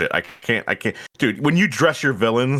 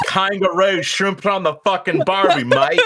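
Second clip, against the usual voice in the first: higher-pitched Aussie caricature; mock Australian accent; 6 semitones higher than usual. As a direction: do a silly broad Australian accent. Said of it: "Auntie Popple would be Australian".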